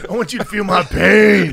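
wrestling promo voice. Pretend. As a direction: threaten like a wrestling villain. Continuing wrestling impression.

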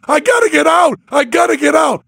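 mock terrified screaming to get out; panicked scream. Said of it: "and I'm screaming".

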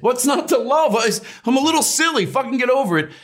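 high, defiant jab at the crowd; half-serious defiance. Retold or imitated. Comic telling a cold audience to get over it, defiant. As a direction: tell them off, half joking, pitch up. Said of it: "I was sort of serious".

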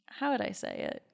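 ordinary delivery

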